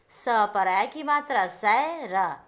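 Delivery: सै र spelled out slowly, ऐ drawn; slow sounding out. Shown as a demonstration. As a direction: sound out सैर syllable by syllable. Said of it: "और इसका उच्चारण देखिए". (धीरे धीरे सै र बोलते हुए)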